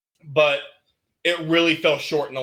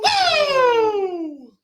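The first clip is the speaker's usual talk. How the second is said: very high, loud wrestler's whoop; Ric Flair woo; 4 dB louder than usual. Retold or imitated. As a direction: answer with your own Nature Boy woo. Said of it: "Nature Boy, baby".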